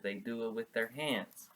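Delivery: usual speaking voice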